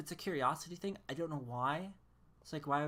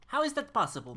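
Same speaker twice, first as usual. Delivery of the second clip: "How is that possible" higher and louder, punched on "how"; exasperated outburst; 8 semitones higher, 8 dB louder than usual. Real, not acted. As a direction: blurt it out in disbelief, much louder. Repeats the question louder.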